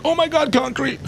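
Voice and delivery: high-pitched imitation of shocked critics; mock outrage. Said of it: "they were like".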